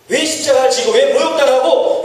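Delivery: ordinary talk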